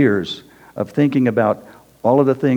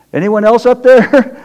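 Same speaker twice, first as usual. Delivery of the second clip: calling out; 11 semitones higher, 9 dB louder than usual. Retold or imitated. Comic reply to God's voice.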